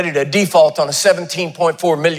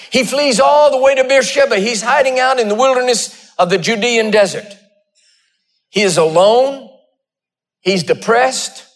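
strong sermon cadence, higher pitched; preaching; 7 semitones higher than usual. Acted. Pastor preaching the Elijah story, rising fervor. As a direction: drive the story home to the pews.

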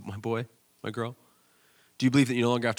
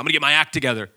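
prodigal son resolve; 6 semitones higher, 8 dB louder, faster than usual. Retold or imitated. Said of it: "that's the prodigal son".

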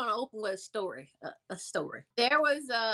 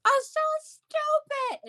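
very high, drawn-out sneering voice; slow, high-pitched imitation of the laughing friend; mocking punchline; 3 dB louder, much slower than usual. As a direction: stretch the insult out slowly and high. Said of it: "she's laughing so hard".

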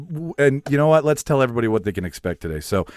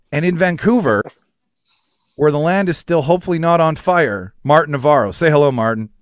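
loud, higher-pitched introduction; show announcer; 7 semitones higher, 6 dB louder than usual. Acted. Host introduces the guest from Vancouver, loud announcer delivery. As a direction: project it like a ring announcer.

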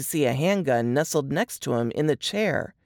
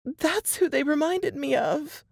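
higher, strained voice of a frightened woman; Laura reliving the horror, voice pitched up; shaken young woman; 11 semitones higher than usual. A narrator's reading. Laura telling Jess and Nate her ordeal, higher and shaken.

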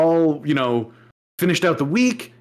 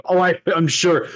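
usual speaking voice

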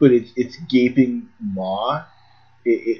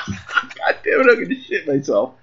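wheezing through laughter; squeezing words out mid-laugh; laughing hard; 6 semitones higher than usual. Real, not acted. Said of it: "laughing too hard".

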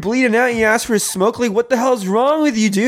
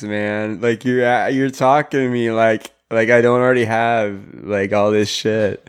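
passive-aggressive; 11 semitones lower than usual. Acted. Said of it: "fighting passive aggressively".

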